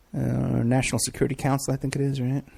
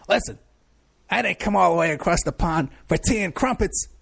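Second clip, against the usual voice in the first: tough New York PI voice, with a British jab; thick New York accent, hammed up; 5 semitones higher than usual. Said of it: "I was putting on my New York accent".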